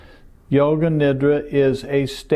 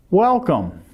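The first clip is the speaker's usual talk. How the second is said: higher, drawn-in greeting that falls away; inviting welcome; 5 semitones higher than usual. Shown as a demonstration. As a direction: welcome them in with a big swooping hello. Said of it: "now I'm inviting you in".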